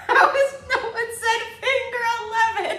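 high-pitched, loud laughing squeal; losing it laughing, voice shooting up; cracking up. Real, not acted. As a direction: squeal the question through real laughter. Cracking up at band names.

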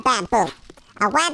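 ordinary talk

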